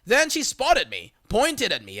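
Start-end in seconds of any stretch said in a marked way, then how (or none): none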